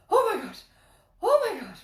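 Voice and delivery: high, loud cry of alarm; panicked exclamation. Retold or imitated. Passenger reliving turbulence on the plane, panicked. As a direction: gasp it out in a re-enacted panic.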